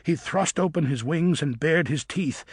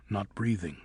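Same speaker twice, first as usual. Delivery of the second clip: ordinary delivery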